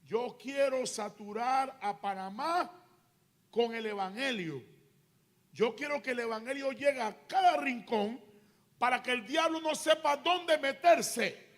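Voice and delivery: loud preaching, building in force; emphatic divine declaration. Retold or imitated. God declares his plan for the nation, loud and building. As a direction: proclaim it as God's own promise.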